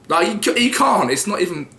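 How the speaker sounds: giving up with a squeal; exasperated. Real, not acted. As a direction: him failing to drop the accent and throwing up his hands. Laughing at failed attempt.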